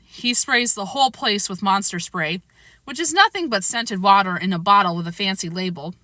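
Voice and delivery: usual speaking voice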